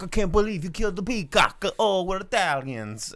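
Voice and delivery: high, loud cartoon Italian family wail; hammed-up Italian-accented outrage; mock Italian accent. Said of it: "And they're like".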